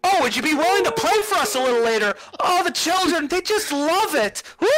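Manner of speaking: high, gushing request; very high thrilled coordinator; eager organizer. Acted. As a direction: plead sweetly, bubbling over. GM voices coordinator asking to play.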